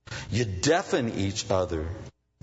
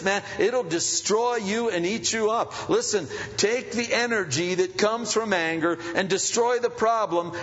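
higher, louder preacher's cry; preaching at full force; 10 semitones higher, 3 dB louder than usual. Acted. Pastor warning the congregation, full-force preaching. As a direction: drive it home to the church.

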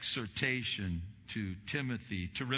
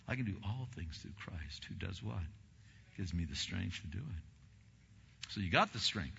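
normal talking voice

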